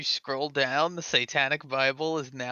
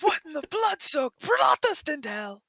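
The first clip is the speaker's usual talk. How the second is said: exclaiming; 12 semitones higher than usual. Acted. Mock outrage exclamation.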